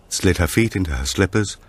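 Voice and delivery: ordinary delivery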